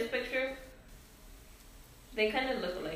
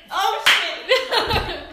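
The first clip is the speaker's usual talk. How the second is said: a sudden loud, higher "oh shit"; surprised outburst; 8 semitones higher, 8 dB louder than usual. Real, not acted. Catching a slip mid-story, blurting out in loud surprise. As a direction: blurt it out when the slip hits you.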